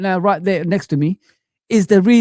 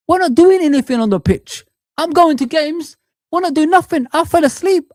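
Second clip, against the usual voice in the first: higher pitched frustrated rant; exasperated outburst; 9 semitones higher than usual. Real, not acted. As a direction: vent it, pitch rising in disbelief. Angry fan ranting at team.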